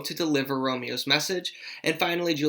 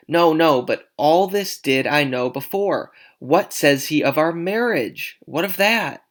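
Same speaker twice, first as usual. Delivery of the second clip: animated, insistent questioning; impatient Juliet; 2 semitones higher, 6 dB louder than usual. Acted. Juliet asks the nurse about the marriage, impatient. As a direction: push for the answer, lifting the last question.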